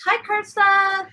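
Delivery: a loud, slow greeting voicing Jack. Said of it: "Jack says".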